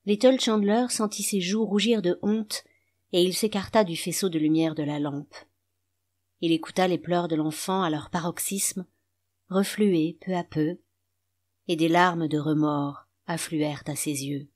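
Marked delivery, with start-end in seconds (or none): none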